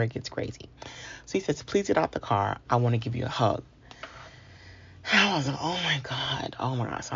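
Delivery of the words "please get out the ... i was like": ordinary delivery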